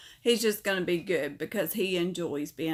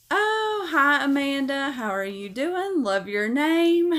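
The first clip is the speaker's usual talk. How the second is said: high, bright burst of hello to a viewer; light up and greet her warmly; excited greeting; 6 semitones higher, 5 dB louder than usual. Real, not acted. Streamer greeting a chat viewer, excited and louder.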